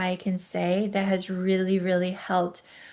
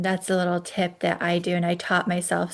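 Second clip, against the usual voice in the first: usual speaking voice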